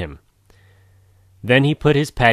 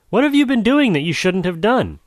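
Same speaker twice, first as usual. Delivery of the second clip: higher, gracious mother voice; mother jaguar voice; 11 semitones higher, 4 dB louder than usual. Acted. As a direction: gently scold the son. Mother scolding son.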